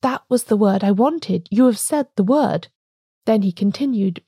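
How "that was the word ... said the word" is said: ordinary delivery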